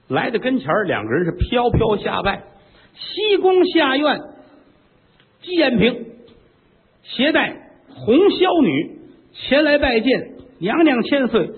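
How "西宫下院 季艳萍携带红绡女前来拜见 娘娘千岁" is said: high, drawn-out mincing court lady voice; court lady greeting. Acted. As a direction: sing out the greeting like a dainty concubine. 妃子拜见，尖声学女声.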